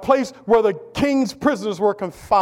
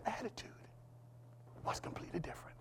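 hushed whisper for effect; 28 dB quieter, much faster than usual. Real, not acted. Preacher hushes the congregation before the turn, whispering. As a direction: drop to a dramatic whisper.

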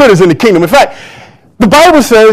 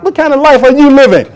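ordinary talk, nothing marked

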